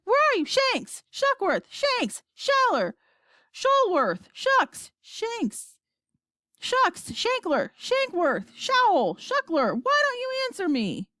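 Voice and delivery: high, frantic calling of names; crying out. A narrator's reading. President calling astronauts on a dead radio, crying out. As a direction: call desperately, each name its own cry. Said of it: "cried the president".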